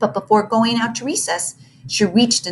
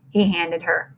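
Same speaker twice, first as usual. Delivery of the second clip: usual speaking voice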